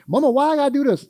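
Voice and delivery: mock child protesting to mama; whiny child. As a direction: whine like a child asking why. Grandma retelling her kids' whining.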